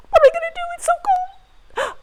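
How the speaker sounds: high, whimpering little sprout freezing; shivering sprout. Pretend. Teacher voicing the freezing sprout for kids, high and whiny. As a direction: wail as a cold little plant.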